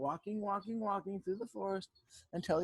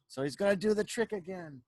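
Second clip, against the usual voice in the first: ordinary delivery, nothing marked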